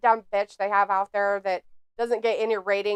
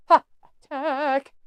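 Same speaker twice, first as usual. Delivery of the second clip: drawn-out pathetic; 3 semitones higher, much slower than usual. Shown as a demonstration. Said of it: "This is pathetic".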